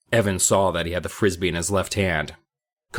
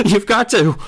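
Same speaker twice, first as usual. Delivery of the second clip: giggling plea; 14 semitones higher, 8 dB louder than usual. A narrator's reading. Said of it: "A high giggle burst out".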